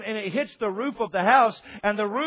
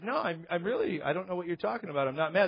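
soft, low, hurried denial; retold meek denial; 3 semitones lower, 6 dB quieter, faster than usual. Pastor denying anger to a couple, soft denial. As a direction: brush it off quietly, a little lower.

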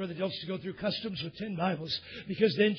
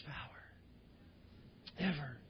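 whisper; 5 semitones lower, 15 dB quieter, much slower than usual. Acted. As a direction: drop to a sudden whisper. Whispered climax of sermon.

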